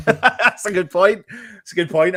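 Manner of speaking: high, loud and laughing; amused agreement. Real, not acted. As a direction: concede the point while cracking up. Laughing at a chat comment.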